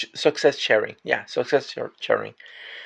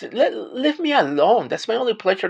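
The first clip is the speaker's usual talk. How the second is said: exasperated; 9 semitones higher, 3 dB louder than usual. Real, not acted. Defending coffee on the floor.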